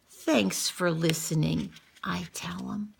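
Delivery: soft whisper to a friend; tender hushed thanks. Acted. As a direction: whisper it to the fish. Whispered to fish.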